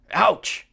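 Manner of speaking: high pained moan; moaned ouch. Acted. Annabeth worn out from the climb, moaning. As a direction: let the ouch come out as a moan. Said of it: "moaned Annabeth".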